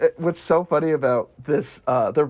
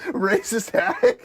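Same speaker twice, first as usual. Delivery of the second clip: put-on old lady insult; 7 semitones higher, much slower than usual.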